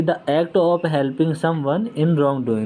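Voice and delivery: each English word laid out slowly for notes; slow dictation. Shown as a demonstration. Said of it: "teacher dictates definition". (धीमे-धीमे बोलकर लिखवाना)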